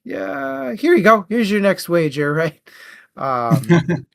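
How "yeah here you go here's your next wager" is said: breezy Americans. Retold or imitated. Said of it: "the Americans bailed him out and were like".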